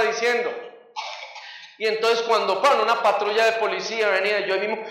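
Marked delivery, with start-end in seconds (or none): none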